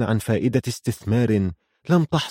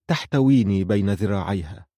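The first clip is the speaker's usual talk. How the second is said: usual speaking voice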